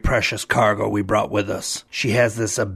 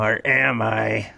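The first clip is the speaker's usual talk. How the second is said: ominous; much slower than usual. Acted. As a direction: drop it slow and creepy. Ominous rhetorical question.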